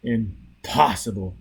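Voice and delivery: ordinary delivery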